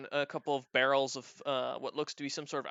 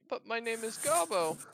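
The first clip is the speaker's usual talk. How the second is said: higher-pitched little goblin objection; lightly voiced goblin, pitched up; protesting goblin; 8 semitones higher than usual. Goblin corrects his new owner.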